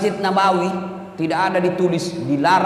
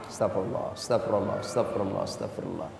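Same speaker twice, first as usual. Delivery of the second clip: low, very soft murmured repetition; quiet istighfar; 10 semitones lower, 12 dB quieter than usual. Shown as a demonstration. Showing the congregation how to pray while sitting, soft low murmur. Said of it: "sambil duduk istighfar".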